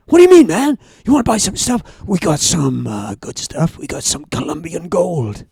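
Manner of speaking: hammed-up American hustler pressing the sale; retold American dealer accent, insistent. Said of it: "transition between an American and Welsh accent".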